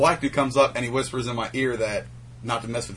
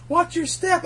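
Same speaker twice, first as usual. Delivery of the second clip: way higher, singsong; high sing-song warning; slower than usual. Retold or imitated. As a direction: warn them in a high voice. Warning passersby, voiced high.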